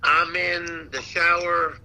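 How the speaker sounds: ordinary talk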